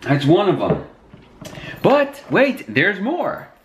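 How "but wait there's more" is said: infomercial voice. Acted. Infomercial catchphrase.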